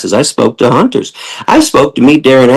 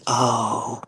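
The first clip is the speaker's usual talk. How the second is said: humbled realization; 8 dB quieter, much slower than usual. Shown as a demonstration. Showing how to respond to the truth, soft and humbled. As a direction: say it like the truth just hit you. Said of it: "Everybody should be saying".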